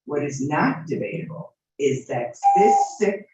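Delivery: ordinary talk, nothing marked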